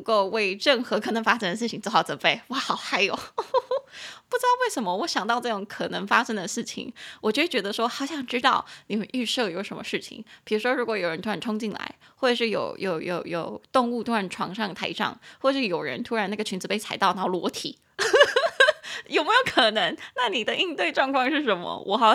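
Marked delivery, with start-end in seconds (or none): 3.2-4.2 squealing gleeful laugh; high giggle. Real, not acted. 18.0-19.0 shrieking burst of laughter; loud high laugh. Real, not acted. Laughing at the idea.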